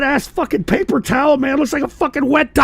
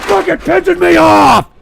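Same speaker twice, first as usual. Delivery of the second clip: furious outburst; 2 semitones higher, 4 dB louder than usual. Real, not acted. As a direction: yell it in pure frustration. Rage at the mess.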